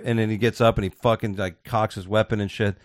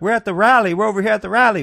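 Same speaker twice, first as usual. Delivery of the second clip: mock caller; 9 semitones higher, 4 dB louder than usual. Woman on 911 call.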